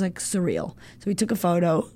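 ordinary talk

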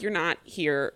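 usual speaking voice

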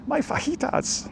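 high, slow, breathless squeak; quoted TV catchphrase. Retold or imitated. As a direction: squeak it out like a cartoon. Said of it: "what television program am I, uh, quoting from?".

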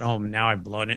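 a quiet sinking inner voice; worried inner thought. Retold or imitated. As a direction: think it to yourself, dreading it.

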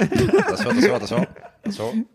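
très aigu, en riant; prévient la bande en chantonnant; annonce excitée. Real, not acted. Annonce comique avant le juron. (alerte amusée et aiguë)

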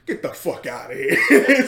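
loud, slow, laughing through it; cracking up while quoting; Paulie's stunned laugh, voiced loud; laughing disbelief. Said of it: "Paulie just goes".

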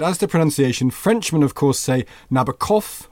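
put-on Nabokov with the accent on the last syllable; lightly voiced Nabokov quote; Frenchmen's Nabokov. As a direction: say Nabokov French-style, stress on the final syllable. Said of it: "and he said".

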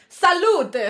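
loud, high cheer; retold French shout. Listener hailing the French dad in Aalborg, retold shout. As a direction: re-enact a listener hollering a French toast. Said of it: "så har hun bare råbt på fransk".

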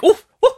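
high, loud excited ooh-ooh; high monkey hoots at a spicy take. Pretend. Hooting at hot take.

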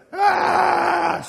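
high, loud imitated shriek; demonic scream. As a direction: shriek like something possessed. Imitates possessed girl's voice.

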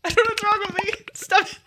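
held-back sneeze, laughing; fighting a sneeze; about to sneeze. Real, not acted. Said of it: "I'm gonna sneeze".

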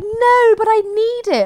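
high, whiny-toddler imitation; protesting small child. Mum imitating her son wanting it back, protesting.

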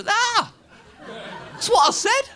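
high, gleeful squeal; mock delighted cry. Happy drunk sharing his jibe with Alistair, high glee. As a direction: squeal it as if sharing great news.

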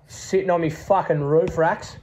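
normal talking voice

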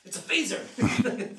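excited. Shown as a demonstration. Director showing the actor how excited the line should be. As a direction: marvel at the cool laser. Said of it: "demonstrating excited delivery".

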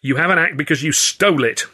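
understated narrated shout; shouting accusation. A narrator's reading. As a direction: accuse him loudly, pitch pushed up. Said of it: "I shouted".

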